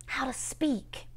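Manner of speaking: slow, near-whispered prayer; hushed plea. Acted. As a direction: let the plea sink to a whisper.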